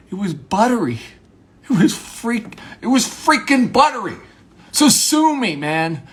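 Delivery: high, heated shouting, building; mock big talk. Defending a buttery scone, shouting defiantly. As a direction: protest as if everything's at stake. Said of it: "have small talk as if it's big talk".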